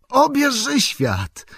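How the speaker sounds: high, drawn-out cry of recognition; Frodo. A narrator's reading. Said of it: "krzyknął Frodo". (wysoki, przeciągły okrzyk)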